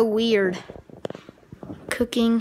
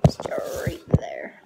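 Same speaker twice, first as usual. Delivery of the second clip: whispering; 7 dB quieter than usual. Real, not acted. Whispered aside, dreading the food.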